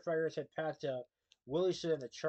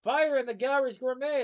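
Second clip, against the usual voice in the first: a high, loud alarm yell; panicked shout; 11 semitones higher, 11 dB louder than usual. Acted. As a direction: sound the alarm across the crowd. Customer shouts fire in crowd.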